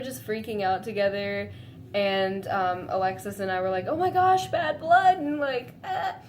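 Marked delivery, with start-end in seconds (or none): 3.9-6.2 fangirl scream. Retold or imitated. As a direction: squeal it together like overexcited fans. Friends freaking out together.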